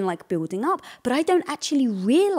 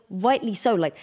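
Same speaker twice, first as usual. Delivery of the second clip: ordinary delivery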